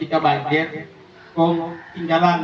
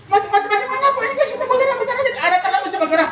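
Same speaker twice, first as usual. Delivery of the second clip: squeaky comic panic over the body; high excited arguing; faster than usual. Acted. The family argues with the doctor in high comic panic. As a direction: insist it's still moving.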